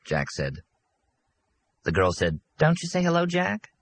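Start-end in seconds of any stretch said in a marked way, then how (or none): 2.6-3.7 higher, lightly mocking woman's voice; teasing woman. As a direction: needle him playfully, higher. Girl teases Jack.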